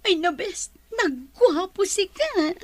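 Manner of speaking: high, slow, swooning squeal over a handsome man; giddy swooning. Acted. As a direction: gush like a smitten girl.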